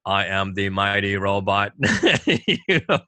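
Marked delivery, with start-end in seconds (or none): 0.0-1.7 low monotone robot; stiff, deep robot impression; robotic voice. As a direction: announce yourself as a robot, low and level. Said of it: "I'd put on a robotic voice".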